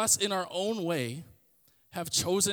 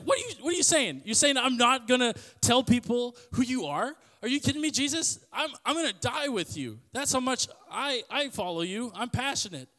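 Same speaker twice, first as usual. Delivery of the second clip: imitating an indignant disciple, high and loud; upset protest; 7 semitones higher, 5 dB louder than usual. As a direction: protest in high-pitched disbelief, acted out. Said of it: "like, upset. He's like".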